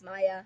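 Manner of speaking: "Maya" said as MY-uh; "Maya" opening with the sound of "eye" after an m; pronounced My-uh. Shown as a demonstration. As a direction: say "Maya" with a first syllable like "my". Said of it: "most people pronounce it as Maya".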